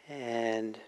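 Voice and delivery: gentle meditation guide with long pauses; guided meditation. Acted. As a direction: guide them unhurriedly into relaxing, leaving space between phrases.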